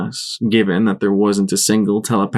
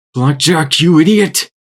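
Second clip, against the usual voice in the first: louder, higher angry snarl; growled; 7 semitones higher, 5 dB louder than usual. A narrator's reading. P-21 growling at Blackjack for stepping out. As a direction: scold her hard. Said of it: "P twenty-one growled".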